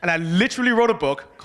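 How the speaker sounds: ordinary talk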